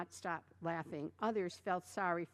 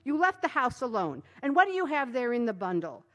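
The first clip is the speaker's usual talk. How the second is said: loud, high scolding; scolding wife; 4 semitones higher, 8 dB louder than usual. A narrator's reading. Wife scolding her husband who came home late, loud. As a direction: scold him like an angry wife. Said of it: "began to scold him".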